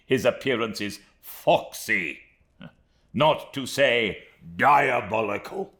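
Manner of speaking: loud, worked-up, sardonic tirade; excited Micawber. A narrator's reading. Micawber to Copperfield, loud and agitated about Heep. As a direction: boil over with contempt for his employer. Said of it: "bursting into a state of much excitement".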